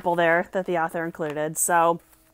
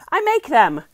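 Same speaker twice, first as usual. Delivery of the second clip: higher, louder eager offer; eager promise; 7 semitones higher, 8 dB louder than usual. A narrator's reading. Sailor Lupe offering to help Nat, eager. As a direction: jump in brightly with the promise. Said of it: "Lupe promised".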